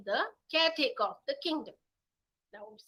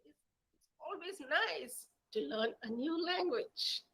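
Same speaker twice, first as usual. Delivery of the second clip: gentle moral; 6 semitones higher, 4 dB quieter, slower than usual. Acted. Mother cat's closing moral.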